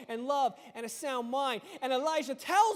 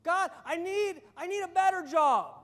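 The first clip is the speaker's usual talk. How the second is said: loud, slow, higher pleading to God; hammed-up begging to God for more; mock begging prayer; 4 semitones higher, 5 dB louder, slower than usual. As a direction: wail it like a greedy prayer. Preacher mocks greedy prayers.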